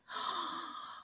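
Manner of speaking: a quick unvoiced gasp of delight; sharp gasp. Real, not acted. Opening a viewer's gift, a sharp delighted gasp. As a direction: catch your breath in surprise.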